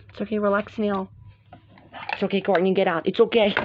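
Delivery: calm them down quietly; soothing. Real, not acted. Reassuring crying Courtney, soothing low voice.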